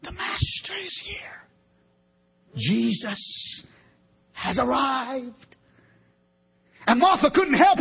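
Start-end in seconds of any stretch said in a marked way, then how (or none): 0.0-5.6 hushed whisper through the crowd; murmured rumor. Retold or imitated. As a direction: breathe it like gossip passing along. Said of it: "a murmur began".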